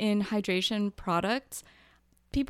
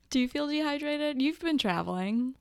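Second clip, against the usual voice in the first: usual speaking voice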